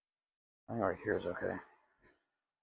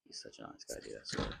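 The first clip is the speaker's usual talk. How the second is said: whispering; 10 semitones lower, 6 dB quieter than usual. Real, not acted. Someone just came in nearby.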